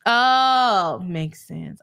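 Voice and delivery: ordinary talk, nothing marked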